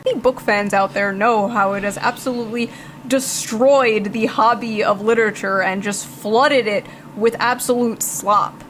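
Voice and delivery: usual speaking voice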